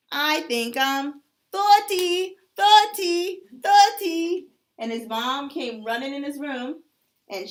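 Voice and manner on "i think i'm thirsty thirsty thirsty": higher, louder, slow drawn-out "thirty" for thirsty; little clock asking mom for a drink; little boy calling. Acted. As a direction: call out "thirty" like a small child.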